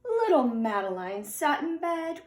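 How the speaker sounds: plain reading voice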